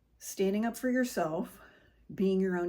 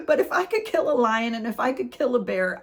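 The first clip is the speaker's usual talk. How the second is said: loud line spoken through laughter; loudly laughing while talking; 3 semitones higher, 7 dB louder than usual. Real, not acted. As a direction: keep laughing through the words. Laughing while voicing David.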